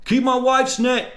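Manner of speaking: high, slow, strained-up impression of a yell. Shown as a demonstration. Showing off an impression of the husband's outburst, high shout. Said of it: "Let me do a [name] voice".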